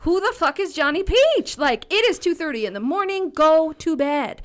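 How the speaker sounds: put-on loud, high, fed-up shout at a kid who won't sleep; exasperated yell. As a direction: yell it like a mom at her wits' end. Mom snaps at child at night.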